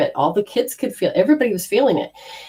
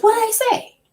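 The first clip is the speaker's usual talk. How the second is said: surprised question. Retold or imitated. Asking husband.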